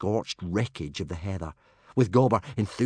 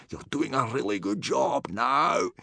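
higher-pitched, pleased praise; delighted; 6 semitones higher than usual. A narrator's reading. Gobber praising boys for not startling herd, delighted. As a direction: cheer them on with delight. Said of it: "said Gobber delightedly".

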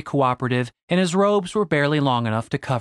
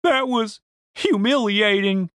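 slow, higher-pitched wounded complaint; sniffling upset; 7 semitones higher, slower than usual. Acted. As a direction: say it sorely after crying. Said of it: "Denise sniffed".

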